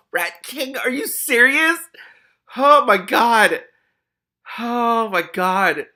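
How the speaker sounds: very high, loud, slow exclaiming; shocked disbelief. Real, not acted. Reader reacting to the rat king reveal, astonished. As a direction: wail it out, incredulous.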